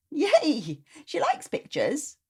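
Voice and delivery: put-on high, bright cheer of a little girl; little girl thrilled at an idea; delighted child. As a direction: squeal it with childish joy.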